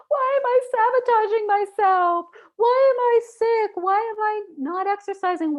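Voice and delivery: high, loud, whiny self-questioning; exasperated inner voice. Retold or imitated. Dieter to herself, a high exasperated whine. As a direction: wail the questions at yourself.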